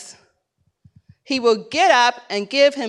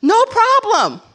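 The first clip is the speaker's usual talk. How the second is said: bright, much higher and louder mock eager yes; cheerful agreement; 7 semitones higher, 3 dB louder than usual. Second clerk happily agreeing to the restructure, mock cheerful. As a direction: gush like it's no trouble at all. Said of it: "This person's like".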